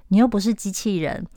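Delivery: usual speaking voice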